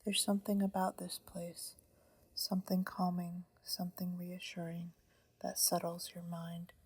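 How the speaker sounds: ordinary delivery, nothing marked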